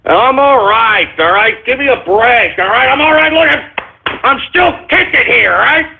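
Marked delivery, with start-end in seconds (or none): 1.7-5.9 pitched high and defensive, insisting he's fine; rattled, higher-pitched protest; worked-up yelling. Real, not acted. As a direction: push back at the engineer, voice climbing. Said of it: "I really shouldn't be yelling and screaming".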